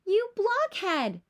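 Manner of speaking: mock angry shout; yelling. A narrator's reading. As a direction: scold the little brother. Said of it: "Lucy yells at her brother".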